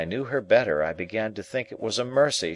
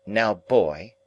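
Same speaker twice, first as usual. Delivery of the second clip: curt, haughty call; haughty girl; 3 semitones higher, 4 dB louder than usual. A narrator's reading. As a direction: order him off haughtily. Estella commands boy.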